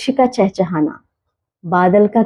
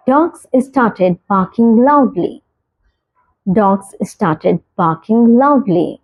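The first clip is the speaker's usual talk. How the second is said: very slow, each word spaced out; slow clear example; much slower than usual. Shown as a demonstration. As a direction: model the sentence slowly for learners. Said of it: "example sentence read slowly". (धीरे, साफ़ उदाहरण वाक्य)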